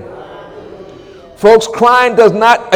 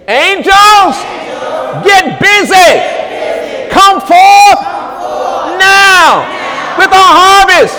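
shouted command; 8 semitones higher, slower than usual. Real, not acted. Preacher commanding angels.

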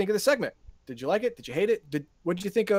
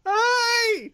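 high scream; 5 dB louder than usual. Retold or imitated. Imitating the scream just mentioned.

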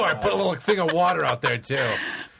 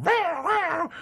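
high cat meows; imitated meowing; 13 semitones higher than usual. Imitating cats when guests come over, high meowing. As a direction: mew like pestering cats. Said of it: "there's cats going".

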